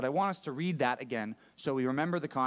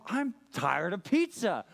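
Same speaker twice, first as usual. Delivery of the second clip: fed-up complaint; 4 semitones higher, slower than usual. Retold or imitated. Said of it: "And then I finally said".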